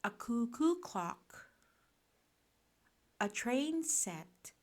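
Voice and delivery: plain reading voice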